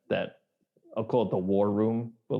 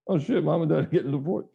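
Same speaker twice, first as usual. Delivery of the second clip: fast, higher mock kid; mock realization; 6 semitones higher, faster than usual. Brother realizing the divorce, mock panic. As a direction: blurt it out in sudden shock. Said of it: "He's like".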